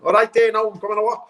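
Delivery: chirpy fake Liverpool accent, rushed. South African comic to agent, fake Liverpool accent. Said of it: "I'm still talking Liverpool".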